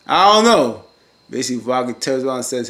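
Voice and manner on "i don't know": loud, high mock exasperation; exasperated outburst. Recapper gives up on foolish Jeffrey, exasperated outburst. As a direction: throw it out loud and high, giving up.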